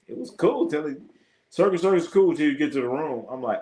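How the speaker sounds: ordinary delivery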